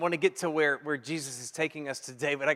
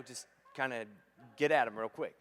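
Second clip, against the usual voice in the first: normal talking voice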